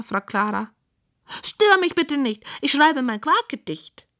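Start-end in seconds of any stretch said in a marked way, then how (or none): 1.4-4.1 high, busy pig voice; high-voiced pig poet; self-important pig. A narrator's reading. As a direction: brush them off in a high piggy voice. Pig shoos Clara while writing.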